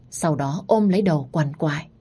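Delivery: usual speaking voice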